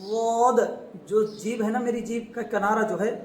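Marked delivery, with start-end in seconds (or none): 0.1-0.6 a heavy emphatic واد; emphatic daad. Shown as a demonstration. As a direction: push واد out from the side of the tongue. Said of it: "دیکھو میرا منہ".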